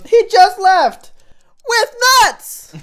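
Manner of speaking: hammed-up movie quote. Quoting a favourite comedy to his cohost, high loud slow voice. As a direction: stretch it out high and loud like the silly character.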